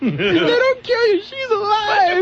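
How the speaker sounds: high, wailing movie quote; hammed-up panic. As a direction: wail the line in mock panic. Quoting Aliens with cry.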